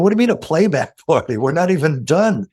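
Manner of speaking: incredulous protest. Retold or imitated. Retold protest about playback party.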